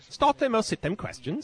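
same phrase, high mock English accent. Shown as a demonstration. Repeating after the host, mock English accent. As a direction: say it back in a hammy British accent. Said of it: "then you gotta do this".

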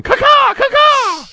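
loud high cawing like a crow. Retold or imitated. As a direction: squawk out a crow call. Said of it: "T Suggs is in the other ear going".

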